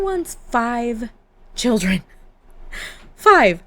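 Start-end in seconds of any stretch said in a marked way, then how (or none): 3.1-3.6 much louder, falling exclamation of disbelief; floored by the number; surprised. Real, not acted. Player reading Anne's wish for five children, loudly surprised.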